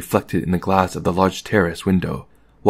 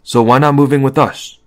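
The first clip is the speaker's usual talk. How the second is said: casual, natural invitation, higher and louder; casual offer; 6 semitones higher, 5 dB louder than usual. A narrator's reading. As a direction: toss it off as the obvious thing. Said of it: "as though it were the most natural thing".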